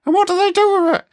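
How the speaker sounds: very high parody of co-host; mock Carol. Host feeds himself sponsor questions as Carol, mock squeaky voice. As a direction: squeak it out, mimicking your co-host. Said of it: "think I sound like that".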